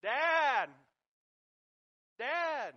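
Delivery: high, loud desperate calling; yelling for dad. Retold or imitated. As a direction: call out to your father from the creek. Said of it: "I started yelling out".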